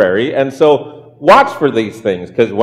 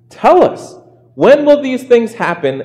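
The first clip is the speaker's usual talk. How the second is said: high, urgent plea for answers; acted disciples' question, pitched up; eager questioning; 9 semitones higher than usual. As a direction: press the teacher eagerly to explain. Disciples privately asking Jesus, read aloud.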